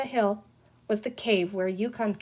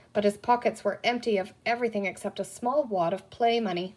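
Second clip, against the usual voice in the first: plain reading voice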